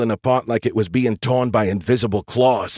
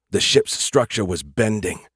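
plain reading voice

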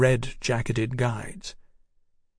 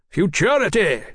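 quip; 8 semitones higher than usual. A narrator's reading. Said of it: "Huxley quipped".

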